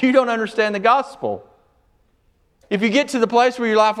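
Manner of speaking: high, loud preaching at full force; fiery preaching. Acted.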